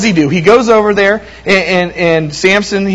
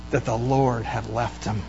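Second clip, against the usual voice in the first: much softer and lower; hushed solemnity; 7 semitones lower, 12 dB quieter than usual. A narrator's reading. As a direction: let the tragic line drop to a grave hush. Solemn climax of Bible story.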